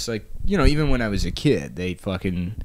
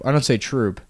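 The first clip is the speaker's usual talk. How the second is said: ordinary delivery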